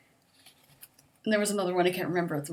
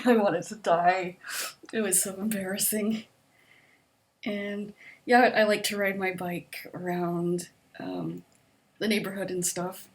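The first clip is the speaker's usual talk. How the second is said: usual speaking voice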